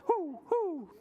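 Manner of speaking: high, swooping owl hoots; owl hooting. Acted. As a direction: hoot like an owl. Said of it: "Owl says".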